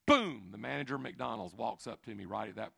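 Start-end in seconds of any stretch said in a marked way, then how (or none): 0.1-0.5 sudden loud boom for the punchline. Acted. Dramatic punchline.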